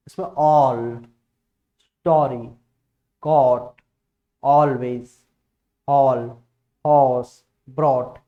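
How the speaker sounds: all, story, caught with a long, loud open aw; long stressed aw. Shown as a demonstration. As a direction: lean hard on the aw vowel. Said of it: "एक बड़ा ओ भी होता है जिसको हम stress देके बोलते हैं".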